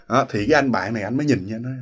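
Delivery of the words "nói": normal talking voice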